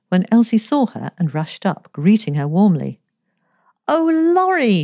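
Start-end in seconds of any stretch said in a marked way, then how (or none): 3.9-4.8 high, joyful call of the name; glad cry. A narrator's reading. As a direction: greet her friend with delight. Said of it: "she cried".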